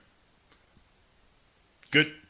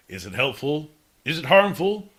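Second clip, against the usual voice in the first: usual speaking voice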